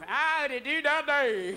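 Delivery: put-on slow, drawn-out drunken singsong. Drunk driver rolls over the abyss, slow happy singsong. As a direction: sing it like you're sloshed and happy.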